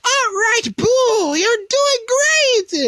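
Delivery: high, slow cheering Babidi. Retold or imitated. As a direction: coax him like a gleeful little wizard. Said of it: "Babidi gives encouragement".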